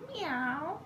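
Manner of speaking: high rising meow; kitty meow. Pretend. Parent meowing like a kitty to the child. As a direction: mew like a small kitten.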